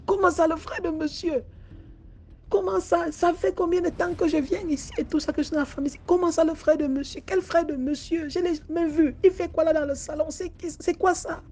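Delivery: high, agitated protest; frightened disbelief. Acted. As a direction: refuse to believe it, scared. (incrédulité effrayée, voix aiguë)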